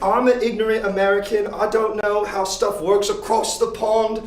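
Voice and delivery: put-on American doing a light British accent. Host on his British-themed stream, lightly put-on British accent. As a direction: keep up a mild British accent all night. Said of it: "I will not stop doing a British accent".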